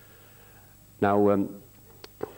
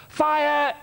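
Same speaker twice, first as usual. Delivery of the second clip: shout. Retold or imitated. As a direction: yell it like a command. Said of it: "Morphy shouts".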